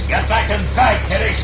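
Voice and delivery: high, urgent call across the yard; urgent warning to Kitty during the shootout. Acted. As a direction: yell her back to safety.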